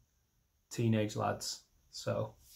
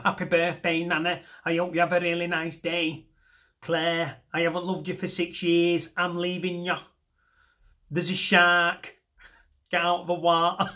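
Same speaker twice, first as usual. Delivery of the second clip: higher, one-note Mancunian whatever the news; monotone Mancunian; 5 semitones higher, 3 dB louder than usual. Acted. Mancunian giving greetings, break-up and warning, all monotone. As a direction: stay on one raised Mancunian note throughout. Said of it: "A Mancunian can only ever picture that monitor".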